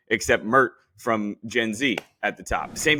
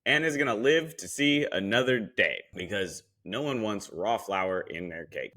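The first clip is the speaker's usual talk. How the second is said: usual speaking voice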